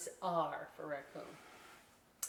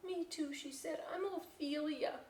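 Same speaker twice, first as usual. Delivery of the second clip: put-on shy kid voice; 8 semitones higher, 3 dB quieter than usual. Ophelia character voice.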